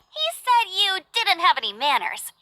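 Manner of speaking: usual speaking voice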